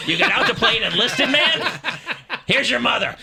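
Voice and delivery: loud, high, incredulous dad outburst; indignant father. Retold or imitated. Navy dad dismissing son's enlisted role, loudly indignant.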